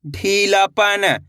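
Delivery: ढीलापन drawn out slowly as ढीला then पन; slow blended reading. Shown as a demonstration. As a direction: model ढीलापन slowly for learners. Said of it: "पहले ढीला पढ़ो". (ढीलापन धीरे-धीरे जोड़कर)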